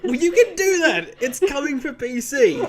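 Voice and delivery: laughing high and loud. Real, not acted. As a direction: say it through laughter. Laughing reply.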